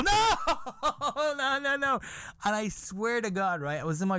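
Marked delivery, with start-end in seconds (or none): 0.0-2.0 mock-horrified cry of no; shocked outcry. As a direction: wail it, then laugh through it. Acting out horrified response, laughing.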